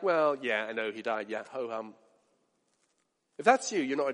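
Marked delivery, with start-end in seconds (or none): 0.0-1.9 low, soft, bored mock reply; indifferent shrug. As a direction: shrug it off like it barely matters. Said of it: "Are you kind of like".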